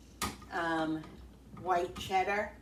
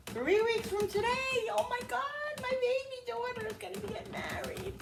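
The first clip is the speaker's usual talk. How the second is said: high, emotional squeal of disbelief; overwhelmed excitement. Real, not acted. Mother counting down to daughter's wedding, high and overcome.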